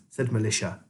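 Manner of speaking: ordinary delivery, nothing marked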